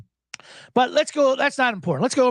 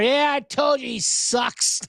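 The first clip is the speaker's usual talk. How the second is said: slow, higher put-on gloating voice; mocking Micah Parsons; 6 semitones higher, slower than usual. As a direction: drag it out like a smug pundit. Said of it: "and he's out there".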